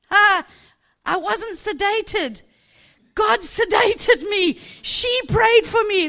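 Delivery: high, loud, slow shouted joy; joyful shout. Retold or imitated. Patient back from surgery telling the ward, joyful shout. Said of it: "shouting at the top of her voice".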